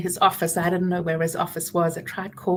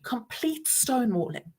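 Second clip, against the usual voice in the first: ordinary talk, nothing marked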